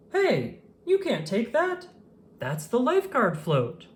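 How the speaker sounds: high, sharp scolding lady voice; a cross woman telling off a monkey; annoyed woman in the pool chair; unhappy woman. A narrator's reading. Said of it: "She did not sound happy".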